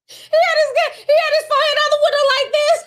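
high, loud, laughing retelling; cracking up. Real, not acted. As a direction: squeal it out, overcome with amusement.

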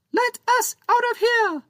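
trapped tiny pirate yelling to be let out; high-pitched shout. Acted. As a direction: shout like a pint-sized pirate trapped in a box. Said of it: "The high-pitched shout".

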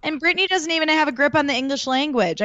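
ordinary talk, nothing marked

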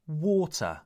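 WATER as 'waw-tuh', no final R; non-rhotic. Shown as a demonstration. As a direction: drop the R at the end of water. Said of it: "accent is non-rhotic".

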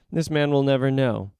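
ordinary delivery, nothing marked

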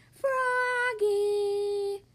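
long, drawn-out high call. A narrator's reading. Grandma calling her runaway grandchild, drawn-out call. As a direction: stretch the name out to call someone back. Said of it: "called Grandma".

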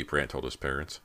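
ordinary delivery

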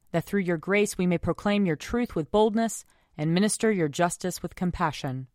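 ordinary talk, nothing marked

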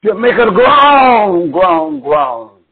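high, loud groan repeated; mounting preacher's wail; preached groan. Real, not acted. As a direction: cry out groan, groan, groan from the pulpit.

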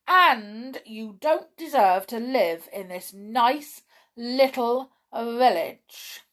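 fierce telling-off of a villain. A narrator's reading. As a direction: hammer out each insult fiercely. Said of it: "said Bron in a very fierce voice".

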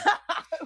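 sudden high-pitched laugh; high burst of laughter. Real, not acted. Reacting to Ron Prince joke.

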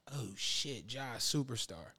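very soft, low and slow realization; hushed awe. Retold or imitated. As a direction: imitate a quietly stunned onlooker. Retold awe at [name] on stage.

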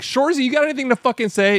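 high mock coach bark; imitating coach yelling. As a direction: call him out like an angry coach. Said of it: "then he's like".

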